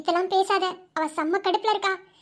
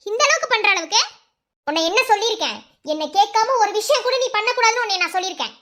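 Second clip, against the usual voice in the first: usual speaking voice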